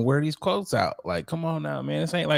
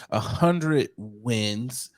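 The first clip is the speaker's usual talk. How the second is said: A hundred wins, each word drawn out very slowly; slow emphasis; much slower than usual. Shown as a demonstration. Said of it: "Emphasis on the".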